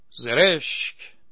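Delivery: loud, very slow drawn-out jeer; mocking shout. A narrator's reading. Someone in the crowd jeering at the actor, long loud shout. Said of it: "فریاد زد".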